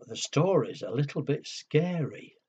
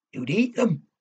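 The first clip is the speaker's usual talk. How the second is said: louder scary dragon threat; menacing boom; 4 semitones higher, 7 dB louder, faster than usual. Acted. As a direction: make the kids jump. Scary story climax.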